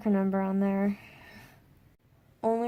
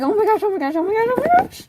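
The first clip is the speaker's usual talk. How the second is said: much higher, loud rapid repeats; frantic panic; 12 semitones higher, 10 dB louder, faster than usual. Retold or imitated. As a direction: spiral into panic. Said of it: "couldn't freaking calm down".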